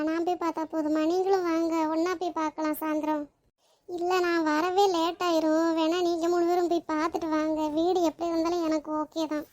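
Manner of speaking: ordinary talk, nothing marked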